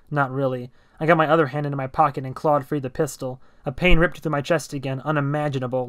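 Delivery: ordinary delivery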